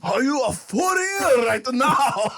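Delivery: put-on slow, high Japanese accent; mock Japanese accent question. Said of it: "I don't speak like that".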